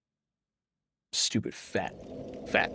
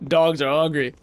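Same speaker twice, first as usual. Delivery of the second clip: usual speaking voice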